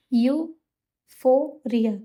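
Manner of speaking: यूफोरिया drawn out slowly and broken into parts; word broken apart. Shown as a demonstration. Teacher breaks यूफोरिया apart so students remember it. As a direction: stretch out यूफोरिया syllable by syllable. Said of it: "इसको हम तोड़ दिया".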